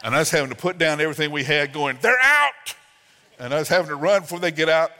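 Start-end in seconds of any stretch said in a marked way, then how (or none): 2.0-2.8 sudden high, loud cry of alarm; alarmed shout. Retold or imitated. Parent shouting to spouse as twins escape, alarmed yell. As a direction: yell it like the kids just broke loose.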